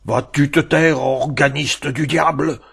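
the bell snaps at the organist, sour and sharp; sour, sharp little bell. A narrator's reading. Angry church bell scolds the organist, high sour cry. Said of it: "dit de sa voix la plus aigre".

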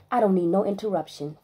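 ordinary delivery

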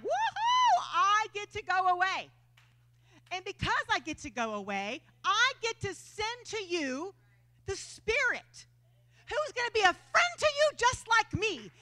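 high, loud, whooping joy; excited Jesus. Retold or imitated. Jesus telling disciples excitedly he gets to go away. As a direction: cheer it out like great news. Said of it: "And he's excited".

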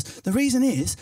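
ordinary talk, nothing marked

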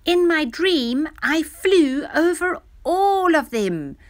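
put-on high, loud storybook child voice; excited recounting. A narrator's reading. Dudu telling her mother her dream, excitedly. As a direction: tell it with loud, high wonder.

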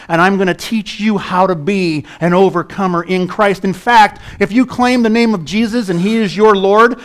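loud, high, driving sermon voice; fiery pulpit preaching; preaching at full force. Acted. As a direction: preach it out to the whole room.

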